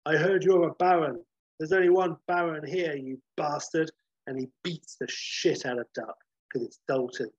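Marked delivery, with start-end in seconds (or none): 0.1-3.9 cocky mock challenge; swaggering challenge. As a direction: taunt him before the punch. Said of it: "pops up and says".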